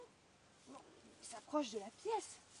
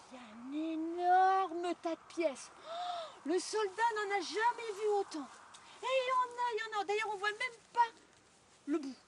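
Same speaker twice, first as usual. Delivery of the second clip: louder, higher amazed telling; excited wonder; 6 semitones higher, 8 dB louder than usual. A narrator's reading. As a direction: marvel at the treasure. Storyteller marvels at treasure.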